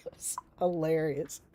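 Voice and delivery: airy whisper wrung out by laughter; barely voiced, still laughing; gasping out the words after laughing; breathless laugh. Real, not acted.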